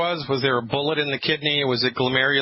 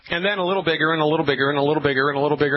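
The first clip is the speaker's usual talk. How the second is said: rapid rattling; much faster than usual. Acted. Rattling off growing sizes.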